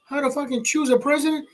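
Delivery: high, rapid-fire scolding; fast, high-pitched incredulous rant; indignant retort. Acted. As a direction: fire back in mock outrage, pitch climbing. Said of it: "And I'm like".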